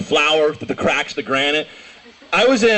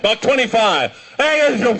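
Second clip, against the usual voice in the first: raised-pitch, exasperated retelling; insistent repeat; 6 semitones higher than usual. Retold or imitated. Bus fare repeated to drunk passengers, higher and insistent.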